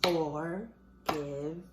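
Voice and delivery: "Forgiven" stretched slowly as for-giv-en; "Forgiven" broken into three slow syllables. Shown as a demonstration. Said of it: "how do we break that into syllables".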